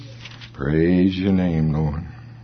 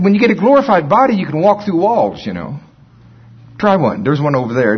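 teasing retort; 8 semitones higher, 4 dB louder than usual. Retold or imitated. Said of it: "Well, I said".

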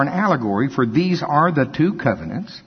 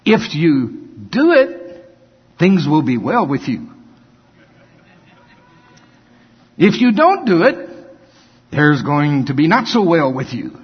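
mock-stern warning; 3 dB louder than usual. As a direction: spell out the consequences like a parent, punching each condition. Grandfather voices warning to grandchild.

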